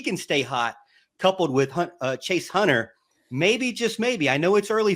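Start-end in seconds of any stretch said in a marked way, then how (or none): none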